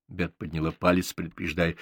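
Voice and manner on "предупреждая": plain reading voice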